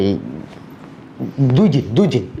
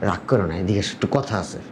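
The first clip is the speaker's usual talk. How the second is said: low, soft coaxing; quiet placating; 7 semitones lower, 6 dB quieter than usual. Acted. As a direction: draw your angry wife aside quietly. Husband placates his angry wife.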